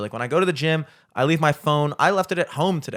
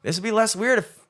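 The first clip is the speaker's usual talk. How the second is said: higher-pitched, mounting disbelief; incredulous onlooker; 7 semitones higher, 4 dB louder than usual. Acted. Onlooker judging the man mumbling without headphones, incredulous. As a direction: build to a high, louder punch of judgement. Voicing an onlooker's judgement of himself.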